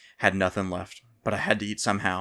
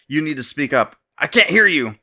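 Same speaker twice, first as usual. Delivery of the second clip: loud, higher shouting back; 6 semitones higher, 9 dB louder than usual. A narrator's reading. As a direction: yell it back at him. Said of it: "the stranger repeated".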